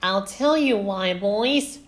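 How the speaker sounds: put-on high, loud, forthright girl; Velma. A narrator's reading. Daughter stepping in to defend coach, high and loud. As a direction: step in high and firm. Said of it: "when Velma stepped in".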